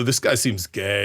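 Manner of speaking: low, slow, snide commenter voice; a lightly voiced snide online commenter; mocking commenter. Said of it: "comments who go".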